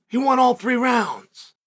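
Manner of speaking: ordinary talk, nothing marked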